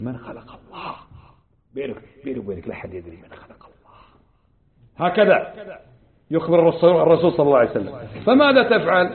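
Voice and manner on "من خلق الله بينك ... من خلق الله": low hushed whisper of the tempter; whispered devil's doubt. Acted. The devil whispering doubts to a believer at bedtime, hushed. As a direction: murmur it like Satan whispering at night.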